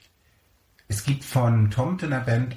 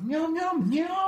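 very high squeaky meows; squeaky high cat chorus; high meowing; 3 dB quieter, much slower than usual. Pretend. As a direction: meow like tiny cats answering. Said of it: "Und dann sagen die anderen so".

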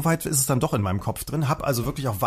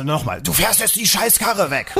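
higher, slower mock yelling; retold bossy order; 4 semitones higher, slower than usual. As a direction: imitate a policeman insisting on an order. Retold policeman yelling his order again. (nachgeahmter, gedehnter Befehlston)

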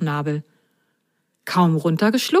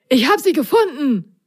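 higher-pitched cry of discovery; thrilled, pitched-up announcement; excited call; 7 semitones higher than usual. A narrator's reading. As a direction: call out your find in excitement. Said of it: "rief Prop aufgeregt".

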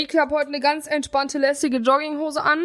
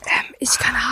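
hushed unvoiced whisper; ASMR whisper; 4 dB quieter than usual. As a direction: keep it barely a breath. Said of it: "du darfst da nicht so laut reden".

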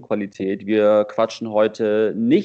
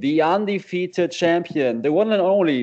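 lucha libre announcer; 6 semitones higher than usual. Pretend. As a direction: hype him up like a ring announcer. Mock fight intro for co-host.

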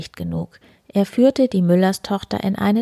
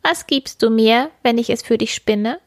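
high, lightly voiced little man; a hint of a curious little man; little man's voice; 6 semitones higher than usual. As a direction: ask like a small odd mannikin. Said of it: "fragte das Männlein".

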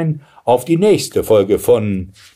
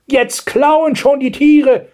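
very high, drawn-out outraged cry; indignant; 5 dB louder, slower than usual. A narrator's reading. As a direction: exclaim like a scandalised grandmother. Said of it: "empörte sich die Großmutter". (empörte Großmutter, sehr hoch)

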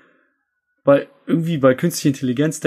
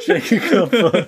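mock knee-slapper; 8 semitones higher, slower than usual. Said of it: "rating own joke".

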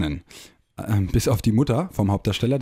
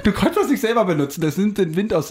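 high burst of real laughter; laughing; 10 semitones higher, 3 dB louder than usual. Real, not acted. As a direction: talk through a big laugh.

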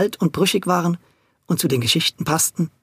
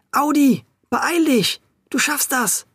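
put-on higher, urgent hushed calling boys; muffled call; 8 semitones higher, slower than usual. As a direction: call over the wall under your breath. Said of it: "riefen die Jungs mit gedämpfter Stimme".

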